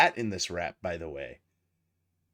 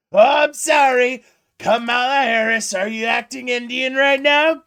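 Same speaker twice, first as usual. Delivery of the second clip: shrill, loud mocking shout, much higher and slower; hammed-up sneer pitched way up; 12 semitones higher, 11 dB louder than usual. Streamer mocking the AI rap's insult, high loud mock shout. Mocking a rap battle line.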